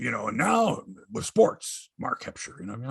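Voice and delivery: ordinary talk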